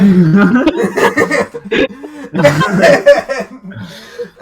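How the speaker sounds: loud, high laugh. Real, not acted. Cracking up at own pizza story, loud high laugh. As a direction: burst out laughing loudly.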